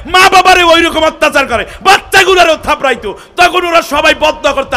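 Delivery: high, loud sermon building to shouting; fiery, shouted indignation from the pulpit; impassioned preaching. Real, not acted. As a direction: preach at full force, driving each phrase harder. Preaches at full force.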